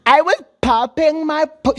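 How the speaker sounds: high, slow mocking girl voice; mock wife quote. Podcaster mocks the wife's old brag in a high voice. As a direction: mimic her bragging in a high drawl. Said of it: "shut up".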